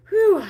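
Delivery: sharp high exhale; loud whew. Real, not acted. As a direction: blow out hard, high. Loud exhale after workout.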